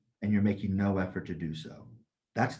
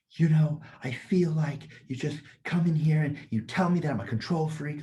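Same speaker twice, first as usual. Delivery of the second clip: mock angry yell; 6 semitones higher than usual. Dutch workshop lady yelling at the teacher, imitated as a high, indignant yell. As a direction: throw her accusation back high and outraged. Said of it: "she was yelling at me".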